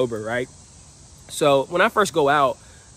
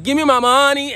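much higher and louder call; loud, high meme shout; hyped trend shout; 11 semitones higher, 6 dB louder than usual. Retold or imitated. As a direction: throw it at the table to start the cheer. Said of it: "I'm like".

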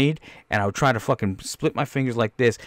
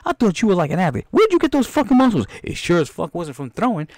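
high, loud outraged rant; angry director's tirade; 10 semitones higher, 3 dB louder than usual. Acted. Director berates his actor over a limp grenade throw, furious. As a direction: chew out a hopeless actor.

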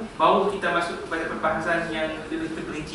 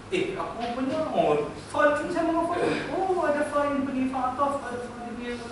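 high, hushed voice of a student working it out; student's sudden realisation; 7 semitones higher, 4 dB quieter than usual. Acted. Teacher acting a student who has memorised the basics, high hushed realisation. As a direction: think aloud high and softly, piecing it together.

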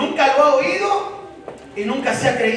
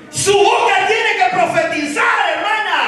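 fiery preaching; 5 semitones higher than usual. Acted. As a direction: call out to the women in the pews. Preacher urging the women.

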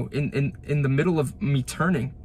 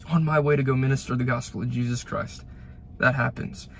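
usual speaking voice

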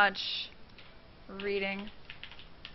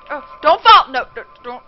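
panicked plea; 3 semitones higher, 13 dB louder than usual. Real, not acted. Pleading with falling rider.

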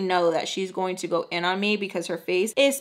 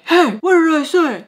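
loud, higher stern warning; stern guard; 8 semitones higher, 7 dB louder than usual. Retold or imitated. Guard scolding the girl, loud and stern. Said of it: "the guard was like".

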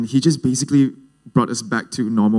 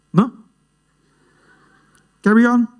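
high, louder mock father voice; put-on dad voice; 12 semitones higher, 5 dB louder than usual. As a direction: do a caricature of your dad, pitched up. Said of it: "obviously that's not his voice".

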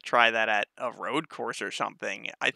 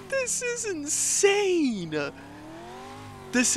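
excited; 3 dB quieter, much slower than usual. Real, not acted. Thrilled by motion rig ride.

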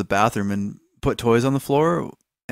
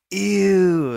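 put-on kids' disgust; 9 semitones higher than usual. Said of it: "both of them were like".

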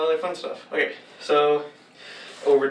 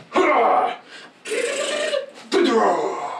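loud triumphant shout; battle cry; 9 semitones higher, 3 dB louder, much slower than usual. Acted. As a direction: cheer it out. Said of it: "he goes".

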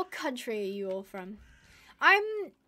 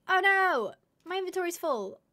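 surprised; 7 semitones higher, 7 dB louder than usual. Real, not acted. Inventory suddenly full.

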